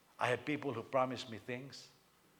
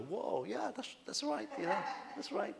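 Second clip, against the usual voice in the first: high, exaggerated whoa, that's right; mock approving reaction; 10 semitones higher than usual. Son reacting to father's toilet wisdom, high mock approval. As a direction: play a kid nodding along, tickled. Acting out his own amused reaction.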